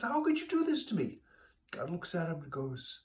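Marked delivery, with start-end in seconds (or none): 0.0-1.2 high, rising outraged whine; a dead man protesting to heaven; outraged plea. Acted. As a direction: plead with God in disbelief. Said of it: "Saul is angry".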